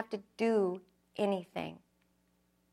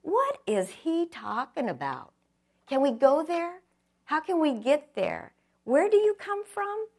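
rising higher and louder, baffled and eager; puzzled disciples; 7 semitones higher, 5 dB louder than usual. Retold or imitated. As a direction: build from confused to pleading questions. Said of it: "I could just see the disciples going".

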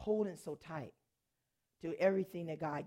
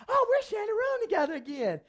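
high, loud, gushing delight; excited; 14 semitones higher, 7 dB louder than usual. Retold or imitated. Happy to room with the young lady again, excited squeal. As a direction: squeal it like a thrilled roommate. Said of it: "I was all excited".

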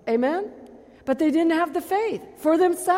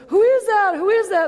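higher, louder blind beggar shouting; blind man calling out; 7 semitones higher, 5 dB louder than usual. Retold or imitated. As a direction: call out eagerly into the crowd. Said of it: "and he goes".

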